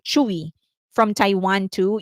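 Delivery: normal talking voice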